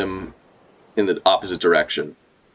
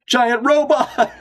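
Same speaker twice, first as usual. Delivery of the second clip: high, slow, amazed cry; excited shout; much slower than usual. Real, not acted. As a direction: shout it in awe. Excited reaction to the robot.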